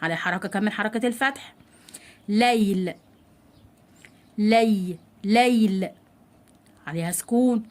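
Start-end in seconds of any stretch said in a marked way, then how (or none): 2.3-5.9 "ليل" with a lengthened, audible y glide; clear ya sound. Shown as a demonstration. Teacher showing children ya with sukun, carefully enunciated. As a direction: isolate "لي", then complete "ليل". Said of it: "لازم انطق حرف الياء بشكل واضح".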